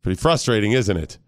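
low, understated prodding; casual needling. Acted. Dad prodding son to get mad, casual low needling.

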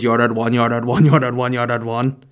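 loud, insistent repeated retort; mock insistence. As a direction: hammer it out as a mock angry vendor. Acted quarrel, vendor repeats himself.

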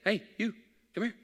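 put-on old grandfather calling. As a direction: call out like a frail grandpa. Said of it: "he says".

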